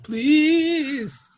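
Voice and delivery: pleading. Pretend. Pleading victim.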